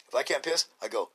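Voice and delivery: ordinary talk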